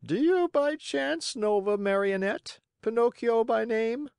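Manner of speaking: high, questioning bird voice; high-pitched curious pigeon; inquiring pigeon. Acted. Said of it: "asked the pigeon".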